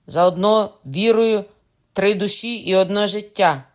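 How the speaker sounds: loud, high proclamation; commanding shout. A narrator's reading. Cocona demanding three souls for one life, shouting. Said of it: "крикнув Кокона".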